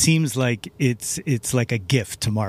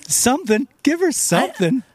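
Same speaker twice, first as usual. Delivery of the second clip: high, eager begging; real excited begging; excited plea; 12 semitones higher than usual. Real, not acted. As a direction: pitch way up, pleading for her. Pleading for Julie Taymor Marvel film.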